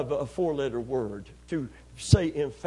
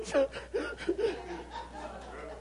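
put-on kid's wail; 11 semitones higher, 7 dB quieter than usual. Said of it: "and the kids go".

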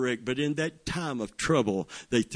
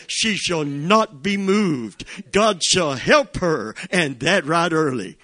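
preaching at full force; 4 semitones higher, 5 dB louder than usual. Acted. Psalm reading builds to climax.

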